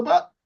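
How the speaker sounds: sharp rising stress on "but"; emphatic but. Shown as a demonstration. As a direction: hit "but" hard and high as the emphasis word. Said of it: "There's no need for emphasis".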